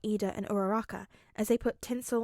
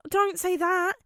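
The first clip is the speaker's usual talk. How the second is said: whining; 9 semitones higher, 5 dB louder, slower than usual. A narrator's reading. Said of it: "Midoriya whines".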